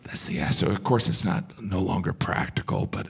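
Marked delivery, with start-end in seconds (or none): 0.0-0.7 "siesta" whispered much lower; deep whisper. Acted. Comic admiring the siesta, low dreamy whisper. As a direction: murmur it dreamily.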